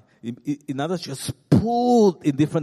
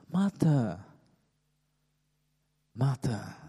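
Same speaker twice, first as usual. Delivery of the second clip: very gentle voice; slower than usual. Shown as a demonstration. The Lord catching Martha's attention, very gentle. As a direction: call the name tenderly, unhurried. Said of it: "saying in a very gentle voice".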